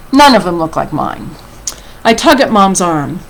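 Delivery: animated read-aloud, lifting with the drama; story reading to children. A narrator's reading. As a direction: read a picture book expressively to children. Reads a picture book aloud.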